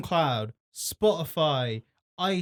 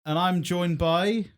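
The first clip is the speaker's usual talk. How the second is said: higher, lifted announcer delivery; podcast host intro; 5 semitones higher, 3 dB louder, slower than usual. Real, not acted. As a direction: open the show for listeners.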